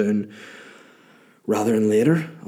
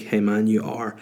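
usual speaking voice

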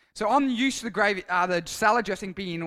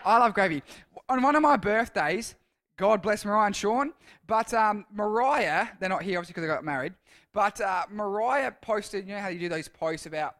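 normal talking voice

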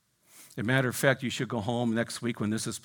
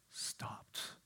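barely audible, dramatic hush; hushed whisper; 19 dB quieter than usual. A narrator's reading. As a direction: whisper it as the storm dies. Hushed end of storm story.

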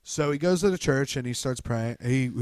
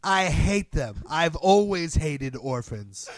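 slow, deliberate defiant repeat; mock stubborn villain; slow defiance; 4 semitones higher, 4 dB louder, slower than usual. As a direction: draw it out, daring him. Retold defiant repeat in standoff.

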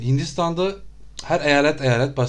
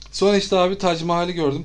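usual speaking voice

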